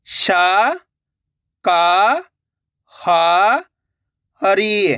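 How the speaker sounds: "शा… का… हा… री", each syllable held long; stretched teaching syllables of "शा का हा हरी"; syllable-by-syllable reading. Shown as a demonstration. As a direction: sound out "शा का हा हरी" with a pause after each. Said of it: "ये शब्द किस तरीके से पढ़ा जाएगा".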